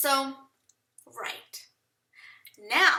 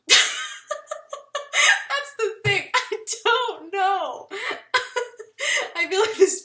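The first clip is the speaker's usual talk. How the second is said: high, slow laughter through the words; laughing; 8 semitones higher, 7 dB louder, much slower than usual. Real, not acted. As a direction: laugh helplessly at yourself. Laughing at her own indecision.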